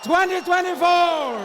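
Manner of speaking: loud, high stage announcer call; announcer shout. Real, not acted. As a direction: belt it out to the crowd. Host opens event on stage.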